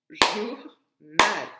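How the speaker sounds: "Journal" loud, split jour-nal; clapped syllables. Shown as a demonstration. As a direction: clap out "Journal" in two loud beats. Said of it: "Deux syllabes".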